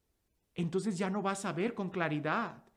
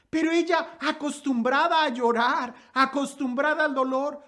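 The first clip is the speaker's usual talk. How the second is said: fervent preaching; 3 semitones higher, 5 dB louder than usual. Acted. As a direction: preach the story out to the congregation. Predica con fuerza el relato. (predicación con fuerza, más alta y fuerte)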